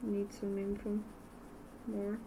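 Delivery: usual speaking voice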